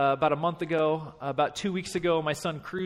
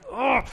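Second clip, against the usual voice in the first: high groan of frustration; exasperated groan; 7 semitones higher than usual. Retold or imitated. Coach watching kids run off again, exasperated groan. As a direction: groan as if at wit's end.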